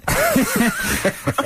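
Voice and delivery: laughing into the question; laughing while mocking the guest. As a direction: giggle through it. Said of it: "Like a kind of this?".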